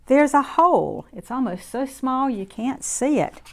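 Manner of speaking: lively storybook voice for little ones; reading to children. A narrator's reading. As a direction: read it warmly to a circle of kids. Reads picture book to children.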